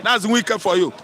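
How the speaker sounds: ordinary talk, nothing marked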